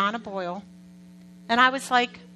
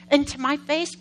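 ordinary talk, nothing marked

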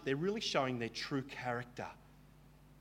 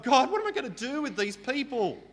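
a higher, louder desperate plea; Moses crying out; 11 semitones higher, 5 dB louder than usual. Retold or imitated. Moses cries out to God, higher desperate plea. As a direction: cry out to God at your wits' end. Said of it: "he cries out to God".

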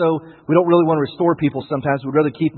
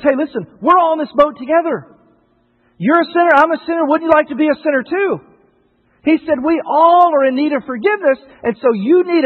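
higher, louder pleading preacher voice; impassioned appeal; 11 semitones higher, 5 dB louder than usual. Acted. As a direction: urge the congregation, all in together.

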